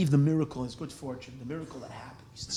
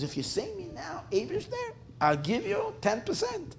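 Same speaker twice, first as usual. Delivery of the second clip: high, softer mock-desperate prayer; pleading bargain; 13 semitones higher, 5 dB quieter than usual. Tied-up merchant offers Hashem a share, mock pleading. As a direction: haggle upward in a small high voice.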